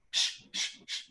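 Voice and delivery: soft, whispered shushing; hushing shush. Retold or imitated. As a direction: hush them up in a whisper. Mom hushing kids' accusation.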